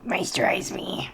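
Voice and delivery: hushed, dramatic plea to the lotion; whispered command. Pretend. Applying skincare, whispering a mock diva command. Sheet mask joke, whispered.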